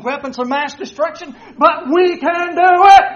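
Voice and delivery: loud, building rally cry to the troops; rousing battle orator. Retold or imitated. Roman orator rallying his army before battle, loud and rousing. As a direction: pump the soldiers up before the fight. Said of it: "a great orator before battle".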